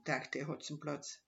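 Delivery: usual speaking voice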